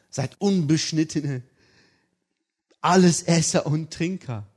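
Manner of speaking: father scandalised at non-Muslims; outraged father. Retold or imitated. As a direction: spit it out in mock outrage.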